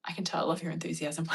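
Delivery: soft unvoiced whisper. Real, not acted. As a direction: whisper it aside. Whispered aside.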